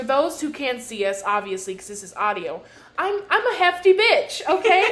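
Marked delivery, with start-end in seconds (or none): 3.0-4.9 loud, high and proud; bold loud declaration. Real, not acted. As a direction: announce it loudly with pride. Proudly owning her size.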